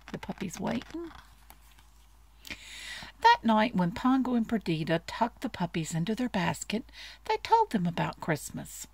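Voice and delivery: ordinary delivery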